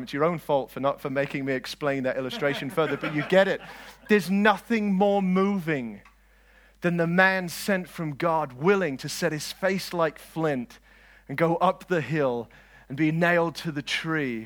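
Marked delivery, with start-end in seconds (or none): 4.1-14.4 slow, loud proclamation; impassioned preaching. Acted. Preacher proclaiming the man sent from God, impassioned. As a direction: drive each phrase home with conviction.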